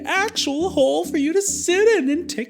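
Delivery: high, lilting mother; mother telling Whole Note about his rest; mother's high voice. A narrator's reading. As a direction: explain the gift in a high voice.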